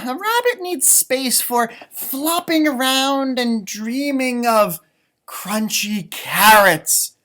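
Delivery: put-on reading to children about rabbit's dreams; storybook reading. A narrator's reading. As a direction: draw it out dreamily for the kids. Reading story text aloud.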